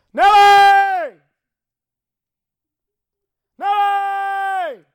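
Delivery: long, high, full-voiced holler; calling the dog. Shown as a demonstration. Owner calls the lost dog from the back door, loud drawn-out holler. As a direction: holler the name out across the yard, drawn out. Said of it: "This is what I do".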